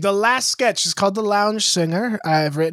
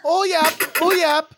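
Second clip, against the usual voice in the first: put-on German accent; 6 semitones higher than usual. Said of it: "with German accents in mind".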